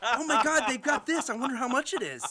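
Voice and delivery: high, loud, laughing excitement; excited shopper. Retold or imitated. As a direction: gush like a thrilled bargain hunter. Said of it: "I'm like".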